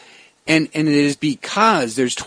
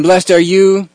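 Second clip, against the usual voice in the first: louder, rising blessing that hooks the listeners; playing to the crowd; 3 semitones higher, 7 dB louder than usual. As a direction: call it out to draw the crowd in. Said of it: "he was actually playing with the crowd".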